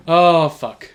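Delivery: ordinary delivery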